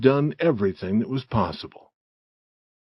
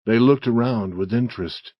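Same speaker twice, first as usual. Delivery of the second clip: ordinary delivery, nothing marked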